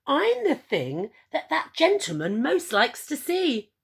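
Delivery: bursting with delight at being chosen; overjoyed Edward. Acted. Edward delighted realising he's liked.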